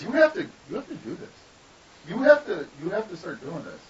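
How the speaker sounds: imitating an excited wife, high-pitched; insistent urging. Wife pushing speaker to take up art, insistent. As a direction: press the point with urgent repeated starts.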